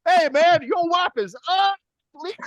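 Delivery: high, loud taunting call; mocking shout. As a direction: yell it like a jeering heckler. Voicing the song's mocking taunt.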